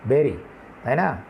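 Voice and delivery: ordinary talk, nothing marked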